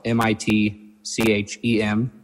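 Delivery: each letter of "M I T C H E M" said separately, letter by letter; spelling it out. Real, not acted. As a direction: spell "M I T C H E M" out one letter at a time. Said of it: "that's spelled".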